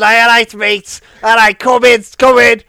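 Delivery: chirpy Scouse welcome, a bit overdone; Scouse accent. Retold or imitated. Stripper waving a customer in, hammy Scouse accent. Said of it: "That's Scouse".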